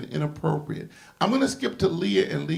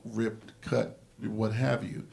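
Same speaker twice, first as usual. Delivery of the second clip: ordinary delivery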